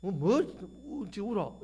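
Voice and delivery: sharp scolding rebuke, rising on the questions; a mild mock scolding of a weeping woman. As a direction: scold her for crying. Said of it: "이렇게 혼을 내는데".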